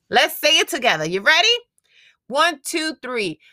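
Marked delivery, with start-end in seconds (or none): none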